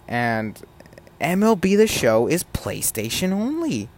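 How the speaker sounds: ordinary delivery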